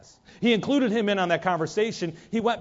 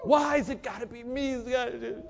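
spouse complaining shout; 7 semitones higher than usual. Acted. Spouse argument acted.

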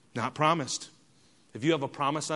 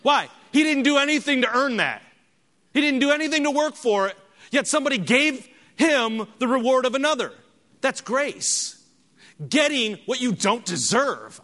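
high, loud preacher's proclamation; raised, impassioned sermon voice; emphatic preaching; 10 semitones higher, 6 dB louder than usual. Real, not acted. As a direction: drive the point home to the congregation.

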